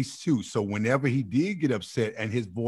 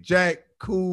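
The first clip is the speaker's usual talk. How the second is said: higher, louder jive catchphrase; seventies hip cat; 8 semitones higher, 5 dB louder than usual. Retold or imitated. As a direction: imitate a cool seventies dude. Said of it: "his terminology was still stuck in nineteen seventy".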